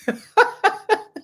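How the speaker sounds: high burst of giggling; nervous laughter. Real, not acted. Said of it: "Ignore that".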